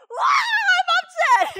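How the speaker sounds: high, loud, drawn-out furious wail; shouting upset child. Retold or imitated. Teacher imitating an upset boy with a loud, slow howl. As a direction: howl it like a kid losing it. It was like.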